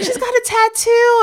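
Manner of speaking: very high excited mom impression; bragging mom. Imitating mom showing off her daughter, high squeal. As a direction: squeal the boast like a thrilled mother. Repeats mother's brag.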